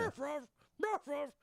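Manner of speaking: high, yappy mock barks; imitated dog bark. Host copying the big dog bit, barking.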